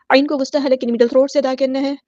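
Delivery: ordinary talk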